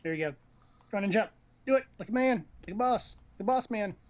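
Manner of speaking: ordinary talk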